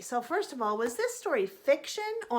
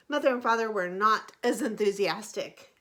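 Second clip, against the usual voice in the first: usual speaking voice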